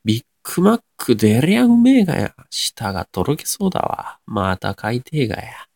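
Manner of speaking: hammed-up Nagoya dialect, big high start then slowing; 名古屋弁. Shown as a demonstration. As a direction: rave in broad Nagoya intonation. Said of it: "名古屋弁っぽい言葉を喋ってみて". (大げさな名古屋弁で)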